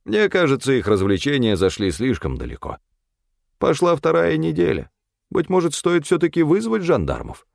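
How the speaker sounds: high-pitched character voice, well above the narration; Стефан. A narrator's reading. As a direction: voice Stefan high, sharing his suspicions. (высокий голос персонажа)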